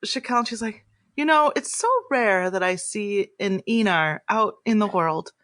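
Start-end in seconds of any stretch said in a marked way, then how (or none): 1.2-5.3 tender, lilting sympathy, rising higher as it goes; gracious celebrity lady. Acted. Idol consoling a rare Enar far from home, tender lilt. GM plays Zashawn.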